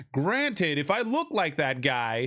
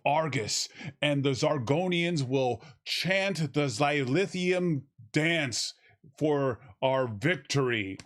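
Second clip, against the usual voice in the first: slow, grand, lower mock-heroic proclamation; mock epic hero speech; 3 semitones lower, slower than usual. Mocking the film's overblown dialogue, in a slow pompous epic voice. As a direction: lay it on thick like a pompous space warrior.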